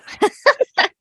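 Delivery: loud high laugh; bright real laugh; high burst of laughter. Real, not acted. Laughs at her own shock.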